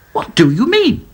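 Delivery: high, pushy question; demanding. A narrator's reading. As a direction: press him for an answer. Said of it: "demanded Dotty".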